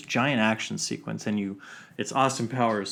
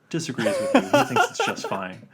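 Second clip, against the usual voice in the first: lightly voiced director, pitched way up; Spielberg impression; 4 dB louder than usual. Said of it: "And he's like".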